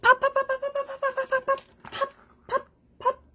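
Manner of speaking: very high, quick pops; high popping sounds. Acted. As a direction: squeak each pop like a bursting bubble. Popping bubbles playfully.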